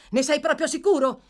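high, loud, urgent question; eager questioning. Acted. Gentleman checking the good news, excited. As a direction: press excitedly for confirmation.

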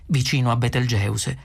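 usual speaking voice

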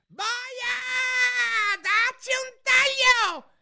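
very high, drawn-out royal shriek; furious scream. A narrator's reading. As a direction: scream the order in a rage. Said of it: "urlò". (urlo rabbioso)

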